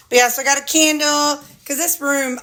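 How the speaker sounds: usual speaking voice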